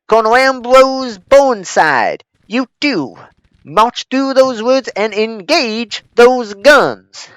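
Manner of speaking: loud Southern officer voice; shouted Southern orders; southern accent. Pretend. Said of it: "I shouldn't have a southern accent when doing that".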